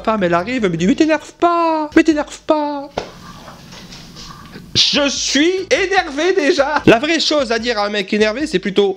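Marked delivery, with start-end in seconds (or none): 0.9-2.9 high, slow, drawn-out wail; mock high-pitched pleading wife; nagging wife impression. Imitates wife telling him to calm down. 4.7-6.8 high, slow, seething; hammed-up strained fury; exasperated anger. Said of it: "je me mets un peu en colère".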